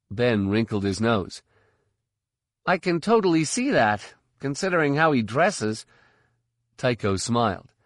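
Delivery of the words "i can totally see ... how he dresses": put-on teasing teen boy. A narrator's reading. Ben teasing.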